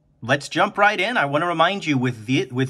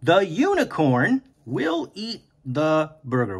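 sentence said slowly and evenly; slow teacher rereading; 3 semitones higher, slower than usual. Shown as a demonstration. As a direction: reread it slowly to track the place. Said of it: "we say the word over and over".

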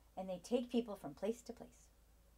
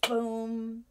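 loud boom; 7 dB louder than usual. Acted. Crash sound for kids.

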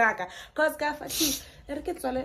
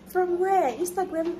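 surprised question; 9 semitones higher than usual. Retold or imitated. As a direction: ask it high like you can't believe it. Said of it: "And I was like".

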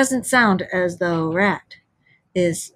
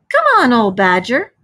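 louder, higher hail across the bank; calling out; 4 semitones higher, 6 dB louder than usual. Acted. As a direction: Rat calls out to Badger. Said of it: "shouted the rat".

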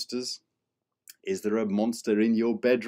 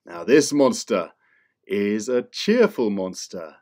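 plain reading voice

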